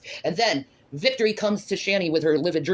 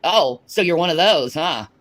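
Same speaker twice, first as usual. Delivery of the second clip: ordinary talk, nothing marked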